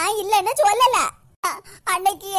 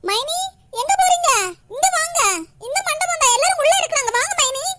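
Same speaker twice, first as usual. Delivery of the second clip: calling out; 5 semitones higher than usual. Acted. Calling out to sister-in-law.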